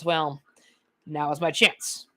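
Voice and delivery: ordinary delivery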